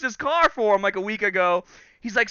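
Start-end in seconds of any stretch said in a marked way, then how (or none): none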